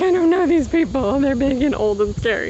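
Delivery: high, scared little voice, half-joking; mock nervous. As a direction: play your younger, nervous self. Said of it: "I was super nervous 'cause I was like".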